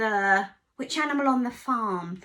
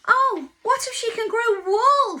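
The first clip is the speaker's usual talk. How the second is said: higher, louder anxious sheep; 10 semitones higher, 9 dB louder than usual. Acted. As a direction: fret like a worried ewe. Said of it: "said Sheep".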